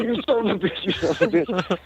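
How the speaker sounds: high, furious retold curse; cussing. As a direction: bark it at the buddy who pranked him. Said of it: "he's cussing the whole time, and he's screaming".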